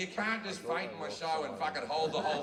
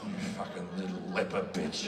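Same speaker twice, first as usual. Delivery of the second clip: Jesus insulting; 12 semitones lower than usual. Retold or imitated. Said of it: "Jesus insults the leper".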